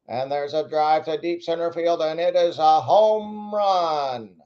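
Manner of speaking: put-on high, slow old-time home run call; 1950s announcer. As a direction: call it like a fifties radio man. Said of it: "Old, old guy, nineteen fifties announcer".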